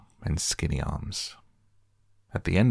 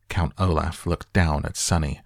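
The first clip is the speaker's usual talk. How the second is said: ordinary delivery, nothing marked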